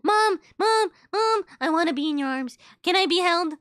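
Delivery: put-on a toddler pet pleading to be carried; begging pet voice. Pet begs mom to carry him, high and whiny. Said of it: "he likes being in Mama's arms".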